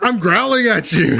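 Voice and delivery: slow, laughing put-on character voice; imitating the character while laughing; mock growl line. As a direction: draw it out playfully like a fake threat. Quoting Barry growling.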